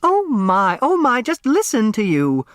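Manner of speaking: high chiding matron; Flora Bombach. Acted. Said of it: "Flora Bombach said".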